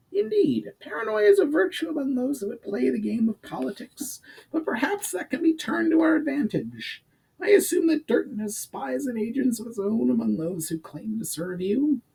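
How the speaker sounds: high, pinched goblin adviser; high-voiced goblin laying out the problem. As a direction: pitch it up like a scheming goblin. Urgar explains his plan.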